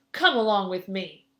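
loud gruff command from a giant. A narrator's reading. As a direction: order them along in a big gruff voice. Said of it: "in a loud, gruff voice".

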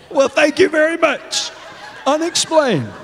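high, laughing voice; amused scoffing. Real, not acted. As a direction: say it through the remains of a laugh. Laughs off the diagnosis.

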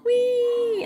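high, drawn-out playful whee; playful squeal. Pretend. Cheering on the swimming game babies, playful high squeal. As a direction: let out a long, high-pitched gleeful cry.

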